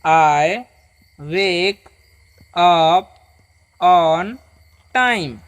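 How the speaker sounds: one word at a time, long pauses between; read-along model. Shown as a demonstration. Teacher leads students to read along, slow word by word. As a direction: stretch each word for repeating. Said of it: "पढ़िए".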